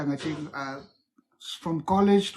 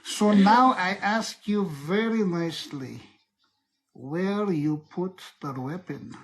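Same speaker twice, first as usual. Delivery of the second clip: slow, menacing Russian interrogator; Russian accent; slower than usual. Acted. Asking a suspect for the weapon, Russian accent. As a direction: drag out the threat in a Russian accent. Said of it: "that's the Russian accent".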